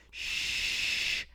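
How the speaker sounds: a sharp hushed shh, no voice at all; shushing whisper. Retold or imitated. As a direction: shush them like a secret. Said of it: "he puts his finger up to his lips and he goes".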